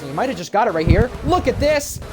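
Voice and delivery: high, excited race call; motorsport commentator. Acted. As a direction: call the overtakes at full energy. Live race play-by-play.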